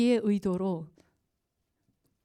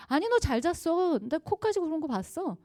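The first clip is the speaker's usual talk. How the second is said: contradicting husband; 7 semitones higher than usual. Retold or imitated. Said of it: "거짓말쟁이로 만들까요".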